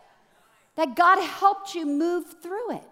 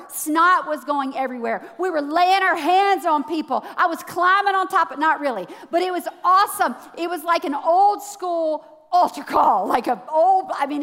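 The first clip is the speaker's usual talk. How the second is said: ordinary talk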